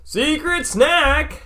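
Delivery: high, slow excited shout; drawn-out squeal of glee. Acted. Announcing the snack excitedly.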